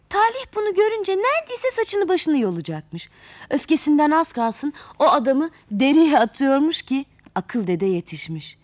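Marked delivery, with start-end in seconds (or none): none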